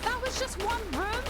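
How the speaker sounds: shocked it's only one room; surprised. Real, not acted. Disbelief at more doors.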